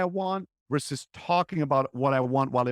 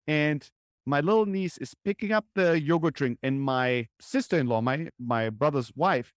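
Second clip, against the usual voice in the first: usual speaking voice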